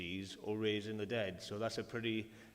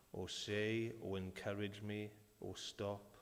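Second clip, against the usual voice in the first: guided prayer; 3 semitones lower, 6 dB quieter than usual. Acted. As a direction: lead them gently into prayer. Leads congregation in prayer.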